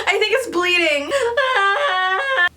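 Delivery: sudden yelp of pain and alarm; startled cry at the blood. Real, not acted. Painful pimple starts bleeding.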